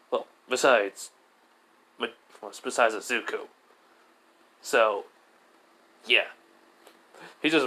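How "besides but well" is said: plain reading voice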